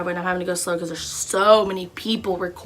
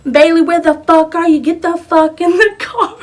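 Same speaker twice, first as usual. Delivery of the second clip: retold yell; 9 semitones higher, 10 dB louder than usual. Lexi yelling from car.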